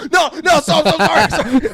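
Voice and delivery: high, loud mock pleading; panicked apology. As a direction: babble a hammed-up plea for mercy.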